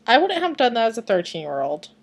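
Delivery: ordinary talk, nothing marked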